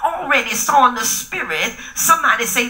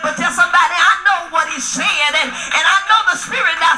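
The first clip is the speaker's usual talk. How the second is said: preaching at full force; 4 semitones higher than usual. Acted. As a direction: whoop it up to the congregation. Preaching over music.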